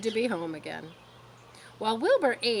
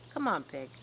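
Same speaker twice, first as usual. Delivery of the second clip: low, soft coaxing; kind farmer; 6 semitones lower, 4 dB quieter than usual. A narrator's reading. Zuckerman coaxing the pig, low and soft. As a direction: coax the pig gently. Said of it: "in a kind voice".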